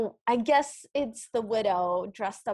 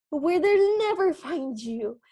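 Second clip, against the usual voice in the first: dramatic widow; 6 semitones higher, 3 dB louder, slower than usual. Retold or imitated. Widow quote, mock dramatic.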